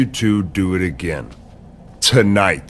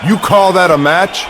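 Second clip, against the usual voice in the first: raised, higher-pitched voice over the crowd; angry commanding; 4 semitones higher than usual. Acted. Angry boss ordering over a crowd.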